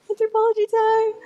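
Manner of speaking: tiny high-voiced cheer; playful singsong echo. Playful echo of the announced segment.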